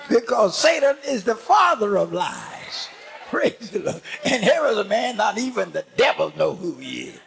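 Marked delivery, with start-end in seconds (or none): none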